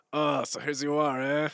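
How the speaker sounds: English in a hammed-up rural Quebecois accent. Streamer answering chat's Quebec check, in a mock rural Quebecois accent. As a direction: put on a thick country Quebecois accent, half-joking. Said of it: "my Quebecois voice is much more like saying English, but in that real rural Quebecois voice".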